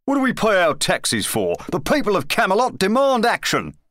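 high-pitched, indignant pomposity; pompous demand. A narrator's reading. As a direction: proclaim it like a self-important editorialist. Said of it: "demanded the editorial crier pompously".

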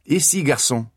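Ici, garçon in crisp French; French phrase said clearly. Shown as a demonstration. Artemis shows how to call a waiter in French. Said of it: "say clearly".

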